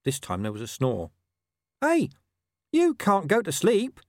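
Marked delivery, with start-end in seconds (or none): 1.8-4.0 high, pleading little dog whine; small dog begging his master to wake, high whine; whining. A narrator's reading. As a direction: whine it like a worried mutt. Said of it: "Gaspode whined".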